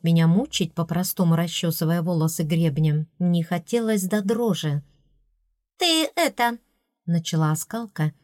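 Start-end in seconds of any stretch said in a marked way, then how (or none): 5.8-6.6 high, unsure fairy voice; hesitant. A narrator's reading. Fairy starting to break news to the princess, hesitant. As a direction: begin uncertainly in a high little voice. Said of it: "её неуверенный тон меня насторожил".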